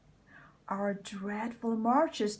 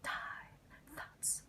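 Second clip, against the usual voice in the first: very soft, secretive stage whisper; barely voiced aside to himself; hushed aside; 20 dB quieter, faster than usual. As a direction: drop to a near-whisper, hiding your thoughts. Hides thoughts as Clarence approaches.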